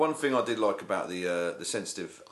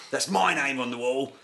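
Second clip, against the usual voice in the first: lightly voiced higher TV guest line; mock talk show guest reciting his catchphrase, higher; 6 semitones higher, 4 dB louder than usual. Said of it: "waiting for him to go".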